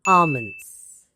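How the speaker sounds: "almonds" as AH-munz, silent l; long open "ah" starting "almonds"; correct pronunciation. Shown as a demonstration. Teacher revealing the health recommendation, silent-l "almonds".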